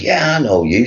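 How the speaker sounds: high, put-on American accent; imitated American accent. American girl insisting she recognises him, mock American accent. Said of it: "with that accent".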